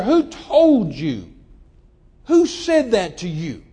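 loud, very slow, indignant questioning; retold anger. Young man to his tearful fiancée about who said it, indignant. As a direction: stretch each word into a forceful demand. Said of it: "It just made me angry".

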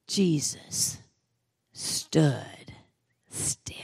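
hushed, breathy stillness; dramatic whisper. A narrator's reading. Preacher hushing congregation at Jesus stopping, awed whisper. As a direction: drop to a whisper for awe. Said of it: "dramatic hush".

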